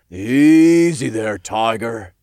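slow him down playfully; warning. A narrator's reading. Imp cautions his fuming friend, warning. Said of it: "Todd warned".

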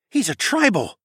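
high-pitched gasped exclamation; shocked gasp. A narrator's reading. Tawa recognizes what the captured mage is, gasping. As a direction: blurt it out, aghast. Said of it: "Tawa gasped and covered his mouth".

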